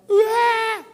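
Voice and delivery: loud, very high mock wail; imitated child's bawl. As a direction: burst out crying like a fallen toddler. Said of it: "ele chorando". (berreiro de criança imitado)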